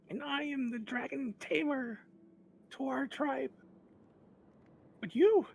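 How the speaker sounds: very high, loud creature voice crying out; a sobbing little kobold begging for help; tearful kobold Meepo. Acted. Meepo the dragon tamer pleading with strangers, high tearful cry. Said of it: "through tears, uh, cries out and says".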